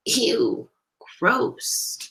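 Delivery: grossed-out little aside; disgusted. A narrator's reading. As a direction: recoil at something gross. Story voices the girl's disgust.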